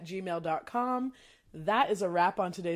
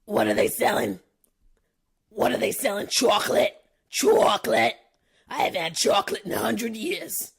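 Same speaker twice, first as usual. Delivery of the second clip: put-on raspy, breathy old grandma; old lady voice; 6 dB louder than usual. Host voices the chocolate grandma, raspy. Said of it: "the grandma".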